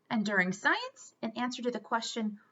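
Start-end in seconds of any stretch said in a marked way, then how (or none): none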